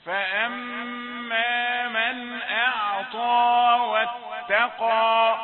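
slow, drawn-out chanted recitation; Quran recitation. Shown as a demonstration. Teacher reciting verses to his students in slow tajweed chant. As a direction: intone the verse slowly and loudly.

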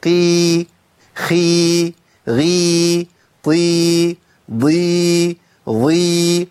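each syllable with a long, clear ee; weakened heavy letters. Shown as a demonstration. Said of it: "بقى ضعيف جدًا".

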